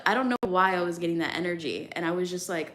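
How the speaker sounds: normal talking voice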